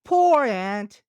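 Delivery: high, loud cartoon grasshopper; bright piping insect voice. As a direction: squeak it out as the carefree grasshopper. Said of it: "she said to herself".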